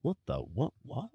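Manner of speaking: mock bewilderment in a brief aside; baffled reaction. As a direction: imitate a confused driver's stunned muttering. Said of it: "Oscar's like".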